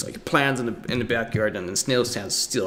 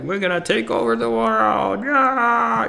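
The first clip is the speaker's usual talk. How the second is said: higher and louder, triumphant goblin rallying cry; gleeful conquest vow; 11 semitones higher, 3 dB louder than usual. Retold or imitated. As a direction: cheer like goblins about to conquer the world. Goblins vowing to conquer the world.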